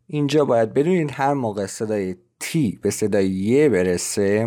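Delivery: ordinary delivery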